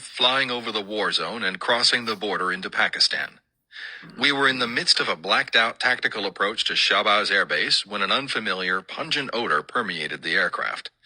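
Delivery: ordinary delivery, nothing marked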